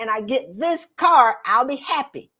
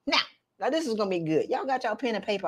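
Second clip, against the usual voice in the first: ordinary talk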